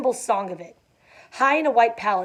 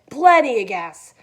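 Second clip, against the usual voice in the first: louder, slow hearty boast; boisterous; 7 dB louder, slower than usual. A narrator's reading. Tom to Gatsby, boisterously brushing off his worry. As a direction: say it big and blustery. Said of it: "said Tom boisterously".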